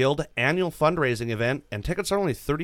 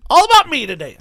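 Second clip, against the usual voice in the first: mock bragging; 12 semitones higher, 11 dB louder, faster than usual. Mock bragging, then "just kidding.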